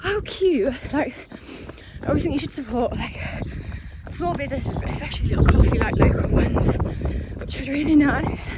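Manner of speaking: panting after a long run; gasping for air. Real, not acted. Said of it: "Oh God, I can't speak".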